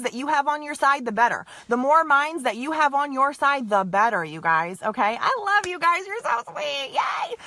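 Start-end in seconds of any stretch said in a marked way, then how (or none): 5.2-7.4 delighted. Real, not acted. Reacting happily to live comments.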